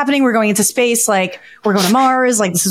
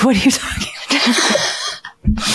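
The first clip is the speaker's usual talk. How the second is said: voiceless, slowed quote dissolving into laughter; words giving way to giggles; laughing imitation; 3 semitones higher, slower than usual. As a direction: act out his reply while cracking up. Imitates Dave, collapses into laughter.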